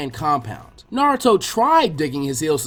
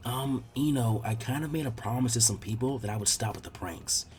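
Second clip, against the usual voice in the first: much softer, lower, confiding; quietly; 5 semitones lower, 10 dB quieter than usual. Acted. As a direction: tell her a secret so others don't hear. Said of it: "he said to her quietly".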